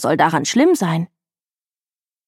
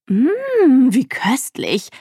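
lick your lips and savour it slowly; 5 semitones higher, much slower than usual. A narrator's reading. Mama tasting the cream off her lips, slow relish. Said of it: "sagte sie".

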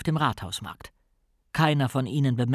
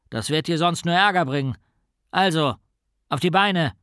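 loud, commanding policeman; stern order; 4 semitones higher, 4 dB louder than usual. A narrator's reading. Policeman orders the boy onto his feet, stern. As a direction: order him up with authority.

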